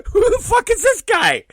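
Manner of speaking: high, incredulous squeal; baffled cry bursting out of laughter; laughing squeal. Real, not acted. Cracking up in bafflement.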